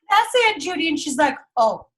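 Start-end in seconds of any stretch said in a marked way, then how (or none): 0.1-0.9 excited recognition. Retold or imitated. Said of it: "And I was like".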